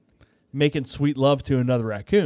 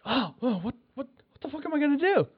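panicked; 10 semitones higher, 5 dB quieter, faster than usual. Retold or imitated. Panicking about the raccoon.

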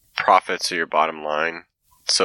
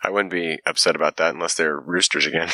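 normal talking voice

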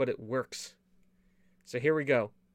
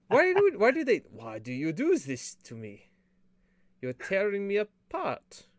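melodramatic movie quote; 5 semitones higher than usual. Retold or imitated. Quoting The Room melodramatically.

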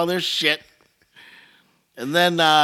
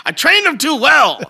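mock proud; 12 semitones higher, 7 dB louder, slower than usual.

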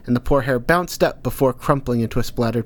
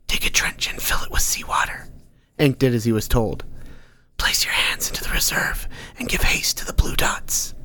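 breathy unvoiced whisper, close and secret. Unseen Nordstrom whispering advice to Ank. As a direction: murmur it like a hidden advisor in his head.

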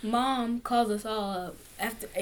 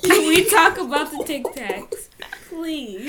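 louder, pleading insistence; loud insisting; 4 semitones higher, 8 dB louder, slower than usual. Real, not acted. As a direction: push the request, a bit louder. Insisting on the topic.

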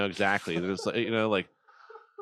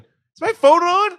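much higher, louder, slow alarmed question; shrieked alarm; 11 dB louder, slower than usual. Acted. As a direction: draw it out high and loud. Caller discovers open line.

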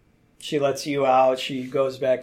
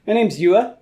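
npc voice; 6 semitones higher, 5 dB louder than usual. Acted. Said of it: "and she goes".

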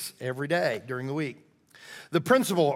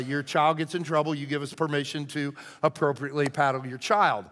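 ordinary talk

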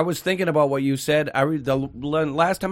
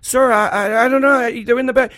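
higher, flustered stammer, imitated; almost crying; 7 semitones higher, 5 dB louder than usual. Young cashier to irate customer, almost crying. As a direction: imitate a rattled cashier apologizing. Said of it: "like, almost crying".

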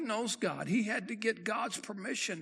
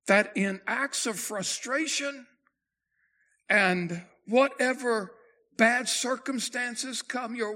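preaching at full force; 3 semitones higher, 3 dB louder than usual. Acted. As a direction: drive the warning home from the pulpit. Preacher builds to a climax.

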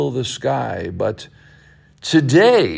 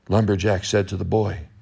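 ordinary delivery

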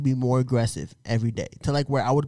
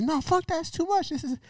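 much higher mock girlfriend refusing. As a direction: imitate her protesting. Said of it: "She's like".

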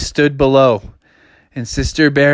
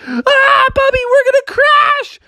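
high panicked squeal. Acted. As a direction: squeal in cartoon terror. Sister panics before crash.

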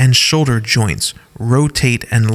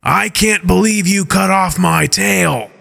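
higher, indignant outburst over his tail; angry; 7 semitones higher than usual. Acted. Rot yells at Skyla over his tail, angry. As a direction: let Rot's anger burst out high. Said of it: "Rot says angrily".

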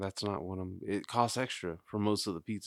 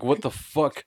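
mock exasperation; 6 semitones higher, 7 dB louder, slower than usual. Acted driver's shock at seeing Corn.

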